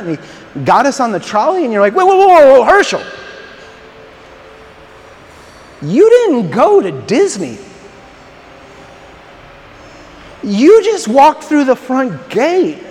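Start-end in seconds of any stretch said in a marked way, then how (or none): 1.9-12.8 incredulous whoa. Retold or imitated. Shocked coworker reacting to Herschel.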